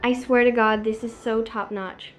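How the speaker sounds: ordinary talk, nothing marked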